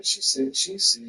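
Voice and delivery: ordinary delivery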